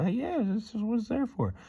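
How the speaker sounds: high-pitched mock lady's reply; lilting high imitation; imitated store lady. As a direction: put on a high voice for the shop lady.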